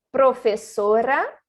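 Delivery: "Professora" with tapped Rs; slow careful "Professora". Shown as a demonstration. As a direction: say "Professora" with light single taps. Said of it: "que é igual".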